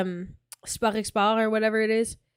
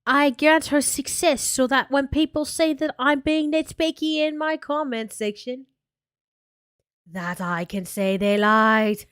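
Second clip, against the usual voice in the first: bad British accent; 2 semitones higher than usual. Streamer mocking a British-Polish vlogger, in a bad British accent. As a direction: put on a badly faked British woman's voice. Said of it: "if I try to do the British accent".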